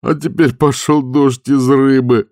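high, loud, slow wailing complaint; groaning lament. A narrator's reading. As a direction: moan it in despair. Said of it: "застонал Дженкинс". (стон отчаяния, громко и протяжно)

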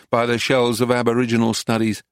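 usual speaking voice

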